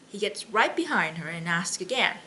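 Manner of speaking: usual speaking voice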